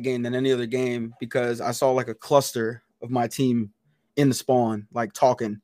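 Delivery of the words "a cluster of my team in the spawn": normal talking voice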